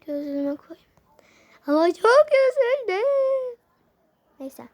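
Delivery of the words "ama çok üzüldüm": high, slow, hammed-up whimper; mock sad whine. Kid lamenting the bad parcel, a hammed-up slow whimper. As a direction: wail the disappointment like a pouty child.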